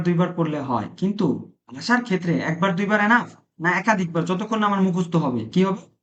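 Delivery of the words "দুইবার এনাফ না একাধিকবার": ordinary delivery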